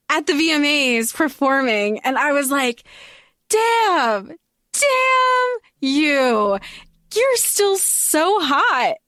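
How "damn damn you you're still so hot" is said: drawn-out, high-pitched gushing; admiring swoon. Retold or imitated. Fan recalling seeing an actor perform, admiring swoon.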